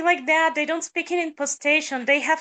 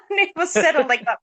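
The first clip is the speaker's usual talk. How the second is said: quick line through laughter; laughing; 2 semitones higher, faster than usual. Real, not acted. Singer joking that her voice decided for her, laughing. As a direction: toss it off fast while laughing.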